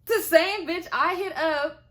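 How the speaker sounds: loud, high, drawn-out indignation; outraged emphasis. Real, not acted. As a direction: hammer out the reveal, loud and slow. Outraged reveal in the story.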